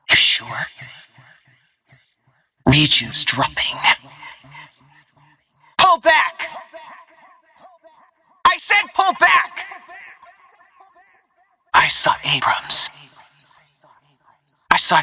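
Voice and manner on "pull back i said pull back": high-pitched combat yell; urgent shouted order. Acted.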